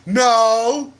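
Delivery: loud, high mock no; mock shout. Friend blurting no to the wife, a mock shout. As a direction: yell the no as she did.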